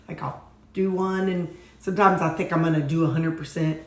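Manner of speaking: ordinary talk, nothing marked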